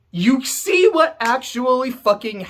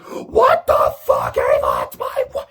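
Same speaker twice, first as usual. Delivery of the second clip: furious shout; 4 dB louder than usual. Retold or imitated. Kraus yells at Ava after his wife is shot, furious. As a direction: scream it at the shooter, then break off. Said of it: "Kraus sees that and is like".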